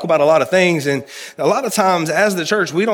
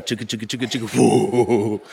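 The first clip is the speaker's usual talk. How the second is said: roller coaster noise; 3 semitones lower than usual. Pretend. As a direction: mimic the coaster climbing then dropping. Roller coaster sound imitation.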